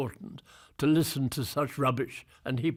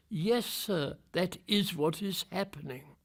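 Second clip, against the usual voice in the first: higher, hushed subordinate's answer; deferential reply; 5 semitones higher, 4 dB quieter than usual. Retold or imitated. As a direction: imitate a junior answering the boss, raised but softer. Acting own reply to director.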